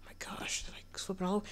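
amused whisper; soft hushed aside. Real, not acted. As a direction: whisper in disbelief. Watching goats jump.

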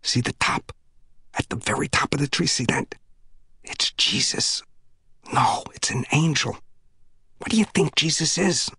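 soft awed whisper. A narrator's reading. Earl whispering to Phil at the window, soft awed whisper. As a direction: hush it, eyes on the tree. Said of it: "Earl whispered".

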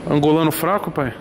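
loud, pumped-up vlogger shout; hyped gym vlog. Acted. Vlogger shouting to followers, loud and pumped. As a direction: hype the camera after the pre-workout.

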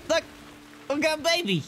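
ordinary delivery

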